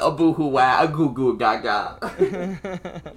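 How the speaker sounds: put-on baby talk. Mocking baby crying sounds.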